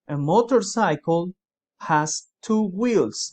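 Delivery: very slow, each word laid out for students; slow model sentence. Shown as a demonstration. Teacher to students, dictating the example very slowly. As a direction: spell it out slowly for the class. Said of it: "vuelvo y les pongo un ejemplo".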